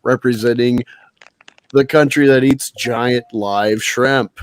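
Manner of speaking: slow, booming ring announcer intro. Retold or imitated. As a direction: stretch every word like a fight night MC. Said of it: "the ringmaster or whatever is like".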